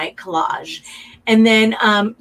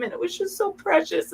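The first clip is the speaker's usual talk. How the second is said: high, soft voice close to tears; real emotion welling up, voice lifts; getting emotional; 9 semitones higher, 7 dB quieter than usual. Real, not acted. Recalling making quilts with her sons, high and choked up. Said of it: "Ugh, I, I'm getting emotional".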